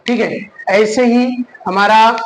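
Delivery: ordinary talk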